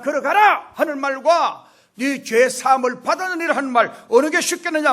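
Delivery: high, loud preaching delivery; forceful challenge. Acted. Jesus challenging the murmuring scribes, forceful. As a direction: throw the question out with authority.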